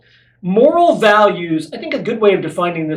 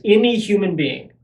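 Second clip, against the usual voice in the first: any human being with weight on any; stress on any. Shown as a demonstration. Preacher repeating the phrase to listeners, any stressed. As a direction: lean on any, let the rest follow. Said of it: "I want to stress that word, any".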